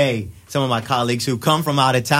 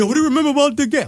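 fast, high eager fan; excited fan; 13 semitones higher, much faster than usual. Retold or imitated. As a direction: rattle it off like a hyped fan. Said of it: "People are like".

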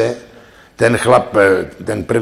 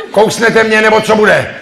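high, loud demanding woman's voice; impatient woman; 10 semitones higher, 6 dB louder than usual. Acted. Woman losing patience with the man, loud high demand. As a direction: snap at him to get on with it.

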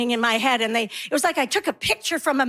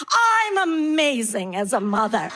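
slow, drawn-out boast; self-congratulation; 3 semitones lower than usual. Acted. Mom proud of her perfect day, self-congratulation. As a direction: savour it, stretching it out grandly.

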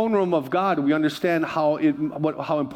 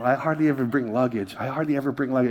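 usual speaking voice

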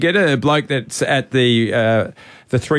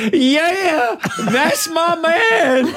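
high, slow, drawn-out jeer, laughing through it; imitating the heckler, cracking up mid-taunt; mock crowd heckle; much slower than usual. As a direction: drag the words out to rattle the shooter. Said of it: "and it's like".

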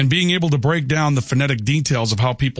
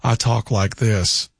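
lower, R-less Southern plantation gentleman; aristocratic Southern accent; 4 semitones lower than usual. Shown as a demonstration. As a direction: put on a genteel old-money Southern accent, dropping the R's. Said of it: "from a high pedigree Southern accent".

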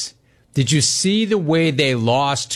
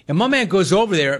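ordinary talk, nothing marked

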